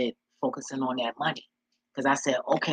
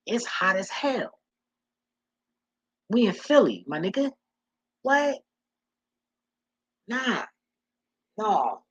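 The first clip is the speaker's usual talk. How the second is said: higher, worked-up disbelief; no way, it's too hot; incredulous; 8 semitones higher than usual. Real, not acted. Telling viewers about the Philly heat, higher and incredulous.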